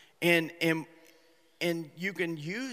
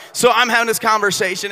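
ordinary talk

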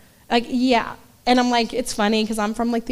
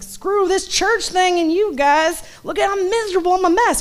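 whiny complaint; 8 semitones higher, 3 dB louder than usual. Retold or imitated. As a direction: act the miserable teenager griping, pitched way up. Teens come back complaining to church.

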